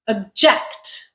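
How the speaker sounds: "object" as ob-JECT; stress on second syllable. Shown as a demonstration. Teacher introduces the verb, "object" stressed on JECT. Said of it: "The way I said it".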